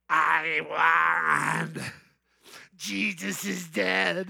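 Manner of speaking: lightly voiced Satan, slow and gloating; gloating Satan figure. Satan figure on the tomb gloats over Jesus, slow put-on voice. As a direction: sneer the victory out slowly. And he goes.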